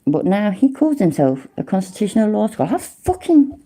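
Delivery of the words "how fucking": incredulous outburst. Real, not acted. Exasperated outburst at rival.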